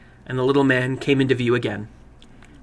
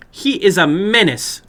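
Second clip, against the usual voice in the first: higher, slow, louder disapproval; stern older woman; 7 semitones higher, 6 dB louder, slower than usual. Acted. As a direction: condemn him plainly. Woman voiced in story.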